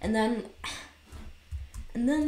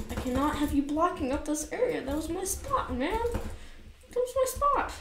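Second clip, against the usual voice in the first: high, climbing gamer complaint; real exasperation, pitched way up; whiny protest; 9 semitones higher than usual. Real, not acted. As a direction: whine higher and higher at the player in your spot. Griping at opponent who took spot.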